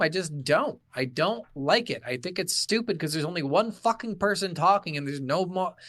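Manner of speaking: usual speaking voice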